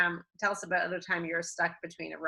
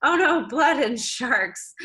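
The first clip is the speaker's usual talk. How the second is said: slow, louder, higher alarmed cry; 5 semitones higher, 6 dB louder, much slower than usual. Retold or imitated. A diver sees his bleeding knee, slow and loud in alarm. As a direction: spell out the panic slowly.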